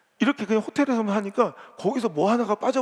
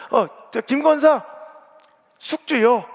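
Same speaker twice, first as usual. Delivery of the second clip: raised, slow, bossy call to a fellow deaconess; 4 semitones higher, 6 dB louder, slower than usual. Retold or imitated. Deaconess calling across the church kitchen, bossy and raised. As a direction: order her to put the sprouts in now. Church women quarrel over cooking.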